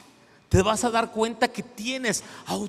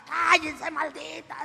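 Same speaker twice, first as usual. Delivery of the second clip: higher mock demonic cry; possessed girl screaming; 6 semitones higher, slower than usual. Pastor retelling the possessed girl's cry, mock shriek. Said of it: "y gritaba".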